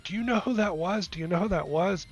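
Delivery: high-pitched, giddy retold questions; excited manager. Manager runs over to massage therapist after session, wildly excited. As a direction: imitate a manager bursting with big news. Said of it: "jumping up and down".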